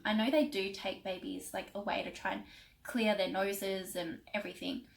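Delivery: ordinary talk, nothing marked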